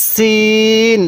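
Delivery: সিন with a thin s, vowel held long; teacher's slow, thin-sounded সিন. Shown as a demonstration. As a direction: draw out সিন on a level note with a fine s. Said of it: "সিনের উচ্চারণটা অনেকটাই চিকন আকারের হবে".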